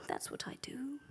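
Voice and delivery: put-on whispered aside with a light Egyptian accent; quiet resigned whisper. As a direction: let it slip out under the breath.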